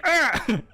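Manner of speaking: loud, high-pitched yelled "ah"; battle yell. Acted. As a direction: let out a short fighting scream. Game master acts out battle cry.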